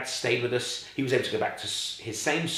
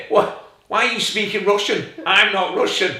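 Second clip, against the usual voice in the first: put-on indignant, louder Eastern European-accented retort; 8 semitones higher, 8 dB louder than usual. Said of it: "he did have an Eastern European tang".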